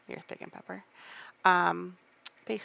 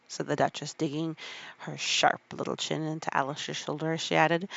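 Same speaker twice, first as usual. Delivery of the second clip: ordinary delivery, nothing marked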